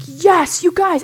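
high, loud whoop of relief on the ridge; excited yell. Retold or imitated. Finally seeing the parking lot, shouting to family, excited. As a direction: call out the good news, thrilled.

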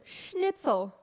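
put-on over-the-top German accent on Schnitzel; mock German. Daughter mocking German sounds, hammed-up German accent. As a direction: spit out Schnitzel like a comic German. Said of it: "Like in German".

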